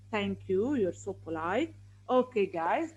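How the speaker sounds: ordinary talk, nothing marked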